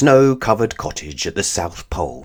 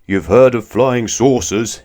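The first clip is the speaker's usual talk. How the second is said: laughing giant; 3 semitones higher, 5 dB louder than usual. Acted. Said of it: "he laughed".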